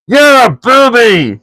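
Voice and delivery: slow, high, loud troll taunt; troll William insulting Tom. A narrator's reading. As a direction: drawl the insult. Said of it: "said William".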